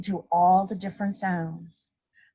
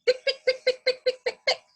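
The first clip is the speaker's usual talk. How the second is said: very high, quick pick pick with a laugh; running heel clicks; faster than usual. A narrator's reading. Lady runs for the bus, fast high clicks. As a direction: rattle it off like heels running. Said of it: "The lady starts to run".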